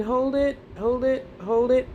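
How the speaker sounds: "hold it" held up high, short repeats; suspended breath-hold cue. Shown as a demonstration. Leading listeners to hold their breath, suspended high cue. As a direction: keep each "hold it" hanging up high.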